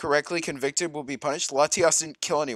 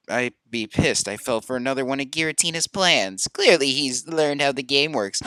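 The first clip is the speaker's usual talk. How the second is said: ordinary talk, nothing marked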